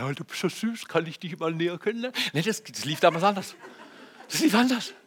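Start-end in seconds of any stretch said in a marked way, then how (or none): 0.0-2.2 put-on smitten teenager. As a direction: murmur a quick, mock-shy chat-up line.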